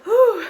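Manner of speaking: loud high exhale of relief; breathless whew. Real, not acted. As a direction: blow out a big breath. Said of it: "I'm still a bit out of breath".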